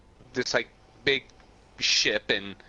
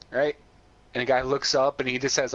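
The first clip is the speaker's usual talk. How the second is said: usual speaking voice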